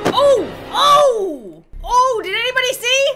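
very high, loud squeals breaking into laughter; shrieking shock. Real, not acted. Player seeing a witness right there, shrieking in shock. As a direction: wail in shocked disbelief, drawing the words out. Sees Yami witness the killing.

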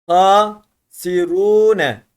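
very slow Hasirune with ru held long; loud, drawn-out Hasirune; elongated reading. Shown as a demonstration. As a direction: stretch the ru in Hasirune. Teacher models elongated Arabic reading.

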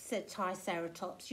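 ordinary delivery

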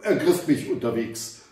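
ordinary delivery

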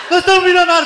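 high, loud celebrating; excited jubilation. Acted. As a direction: shout it like a new millionaire. Ricardo still celebrating loudly.

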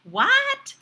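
high, loud, puzzled 'What?'; baffled server. Acted. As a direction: voice leaps way up in confusion. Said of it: "why is your server going".

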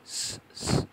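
"স, স" as two pure hisses; two separate unvoiced hisses for "স"; s sound demo. Shown as a demonstration. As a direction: hiss each "স" without any vowel. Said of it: "শোনা যাচ্ছে কিনা জানি না".

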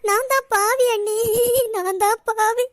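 slow, wailing self-blame; anguished tearful cry. Acted. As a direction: lament your guilt through tears, dragging the words. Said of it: "முதல்ல அழுவைய நிப்பாட்டுங்க".

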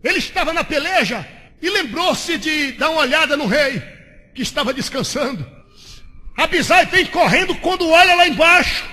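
loud, high, shouting sermon delivery; fiery Pentecostal preacher shouting the story; full-force preaching. Acted. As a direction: preach it out, building to a roar.

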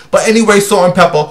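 usual speaking voice

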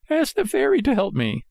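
high, sorry puppet voice; high-pitched, wheedling marionette; pleading Pinocchio. A narrator's reading. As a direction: beg sweetly in a small high voice.

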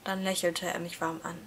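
usual speaking voice

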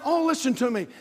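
ordinary talk

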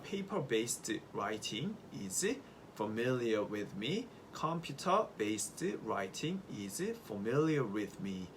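very slow, clear reading of each word; slow model sentence. Shown as a demonstration. Teacher reads example sentences to students, slowly. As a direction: read the examples slowly for learners. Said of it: "이렇게 얘기할 수가 있겠습니다".

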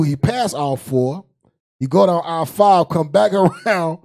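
ordinary talk

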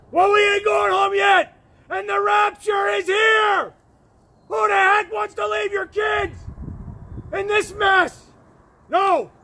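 rally them like a sermon; rousing shout. Real, not acted. Rousing preachy outburst.

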